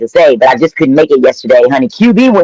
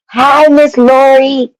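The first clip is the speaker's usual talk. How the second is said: excited greeting; 11 semitones higher, much slower than usual. Real, not acted. Greeting a viewer in chat.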